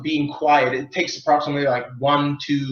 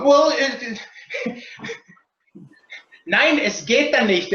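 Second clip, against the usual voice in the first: mock German accent, a touch higher; hammed-up German speaker arguing; 7 semitones higher than usual. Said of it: "I always have to do the German accent".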